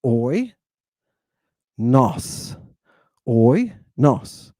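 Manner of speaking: "Oi, nos" as two separate syllables, oy, noss. Shown as a demonstration. Teacher sounding out Greek for students, "Oi, nos" in two separated syllables. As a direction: clear gap between "Oi" and "nos". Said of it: "Two syllables".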